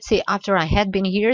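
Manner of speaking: ordinary talk, nothing marked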